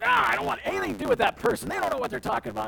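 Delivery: high, fast, fed-up brush-off; hammed-up annoyed dismissal; mock irritated. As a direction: rattle it off like someone sick of a person. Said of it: "it's like".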